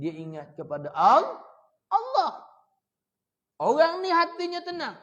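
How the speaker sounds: loud, high, impassioned sermon voice; preaching at full force. Acted. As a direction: thunder it at the congregation, building to a peak on the last words. Preacher explains verse at full force.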